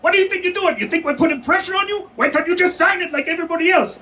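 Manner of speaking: very high-pitched, indignant tirade; mock angry yelling. Official berating a detainee who signed under duress, mock yelling. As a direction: imitate an irate official ranting in a high, rushed voice. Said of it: "they start yelling in my face".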